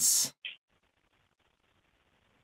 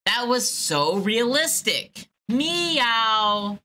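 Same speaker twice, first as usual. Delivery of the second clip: high meow imitation; cat meow; 4 semitones higher, much slower than usual. Caller showing off her cat sound. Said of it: "very accurate cat sound".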